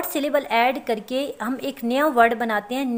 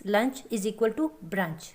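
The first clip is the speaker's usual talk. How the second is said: slow dictation; 2 semitones lower, 5 dB quieter, much slower than usual. Shown as a demonstration. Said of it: "हम इस तरह से भी इसको समझ सकते हैं".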